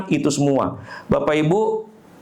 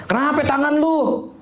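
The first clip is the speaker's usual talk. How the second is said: much higher, slow exclaimed question; retold concerned question; 12 semitones higher, slower than usual. Student's father notices the injured arm, high drawn-out startled question.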